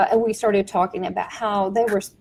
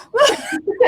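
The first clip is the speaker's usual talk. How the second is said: shrieking laugh; 14 semitones higher than usual. Real, not acted. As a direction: let a squealing laugh burst out. Laughing at childhood outrage.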